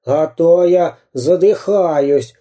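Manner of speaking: high, slow, worn-out whining; exhausted cartoon bandit wailing; pleading villain. A narrator's reading. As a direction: beg and scold while running out of puff. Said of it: "умолял он".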